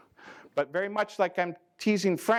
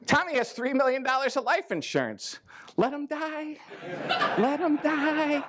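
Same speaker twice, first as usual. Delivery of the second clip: gleeful mock wish; 7 semitones higher than usual.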